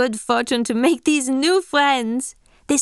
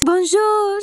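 bright French greeting; 8 semitones higher than usual. Acted. French girl greets in intro.

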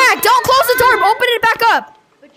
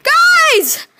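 shout; 9 semitones higher than usual. Real, not acted. Calling to teammates in game.

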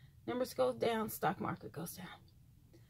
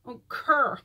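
southern drawl; 6 dB louder than usual. Retold or imitated. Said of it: "these accents kill me".

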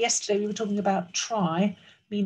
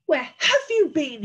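loud, high, indignant demand; thundering; 7 semitones higher, 6 dB louder than usual. Acted. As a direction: thunder it as a cross king. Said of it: "he thundered".

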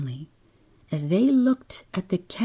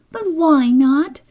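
put-on high, loud little-girl protest; protesting child; 11 semitones higher, 7 dB louder than usual. A narrator's reading. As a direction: whine back at mother, high and loud. Child protests mother's refusal.

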